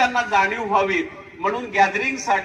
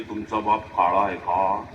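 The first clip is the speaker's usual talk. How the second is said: mocking student; 13 semitones lower, 4 dB quieter than usual. Mocking student asks about father.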